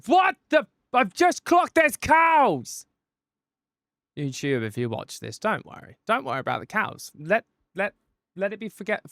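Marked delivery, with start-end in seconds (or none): 0.1-2.9 high, loud shout of surprise; startled outburst. Real, not acted. Streamer suddenly spots cows in the video, shouting in surprise. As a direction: cry out, stretching the words in real shock.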